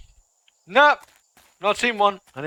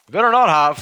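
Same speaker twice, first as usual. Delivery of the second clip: high, loud "Better not have" with "not" hit hard; loud warning; 8 semitones higher, 11 dB louder than usual. Real, not acted. Gamer warning about the rug, loud threat stressing "not". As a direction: shout the threat, leaning on "not".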